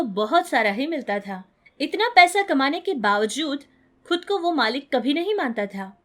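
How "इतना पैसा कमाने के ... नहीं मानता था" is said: plain reading voice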